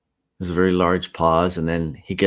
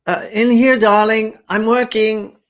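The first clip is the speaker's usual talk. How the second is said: high, loud call from the next room; calling back; 13 semitones higher, 8 dB louder than usual. Acted. Wife calling back to husband from the sewing room. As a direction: call out to him across the house.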